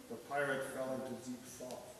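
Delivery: ordinary delivery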